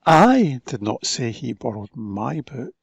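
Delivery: "I" hit first and loud; stress on I. Shown as a demonstration. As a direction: lean on "I" at the start. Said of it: "different emphasis on different words".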